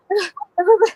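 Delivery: mimed winded gasps; out-of-breath panting. Retold or imitated. Reaching dad out of breath, acted panting. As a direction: pant hard as if fresh from running. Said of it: "I'm out of breath".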